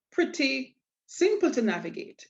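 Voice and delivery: normal talking voice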